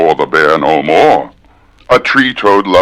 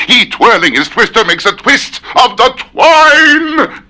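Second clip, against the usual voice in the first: rising pitch; 8 semitones higher than usual. Shown as a demonstration. Pushing the tongue twister faster and higher. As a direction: voice climbing higher and higher. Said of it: "I can do that faster".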